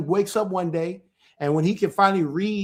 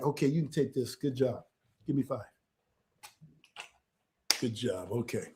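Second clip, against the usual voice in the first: quiet praise; 7 semitones lower, 11 dB quieter than usual. Real, not acted. Father praises his child after the quiz, quietly. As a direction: drop the voice for gentle praise.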